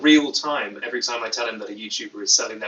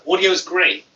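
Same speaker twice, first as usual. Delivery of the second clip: louder, pleased read; 6 semitones higher, 7 dB louder than usual. Real, not acted. Vlogger happily reads a viewer saying audio is great. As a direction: cheer the comment a bit louder. Cheering chat comment again.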